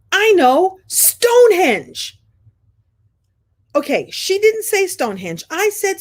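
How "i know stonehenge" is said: excited realization. Retold or imitated. Sudden realisation.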